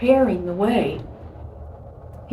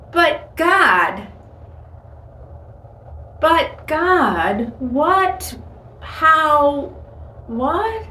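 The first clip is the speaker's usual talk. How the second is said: startled protest; 6 semitones higher, much slower than usual. Acted. Believer answering God's instruction, bewildered protest. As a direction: object in disbelief, halting between words. Acts out a startled, doubting reply.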